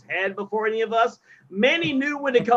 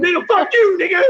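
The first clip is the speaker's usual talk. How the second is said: heated, higher-pitched comeback; aggressive; 9 semitones higher, 5 dB louder than usual. Real, not acted. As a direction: fire back aggressively, starting loud. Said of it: "That's aggressive".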